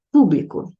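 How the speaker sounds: Público with stress on pú; Público, proparoxytone stress on pú; stressed on first syllable. Shown as a demonstration. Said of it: "O público existe com acento".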